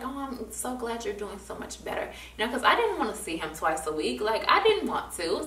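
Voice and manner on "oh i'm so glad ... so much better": much softer, gently pleased therapist; soft relief. Retold or imitated. Therapist to student faking fine, soft relief. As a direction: say it softly, relieved. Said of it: "he was just like".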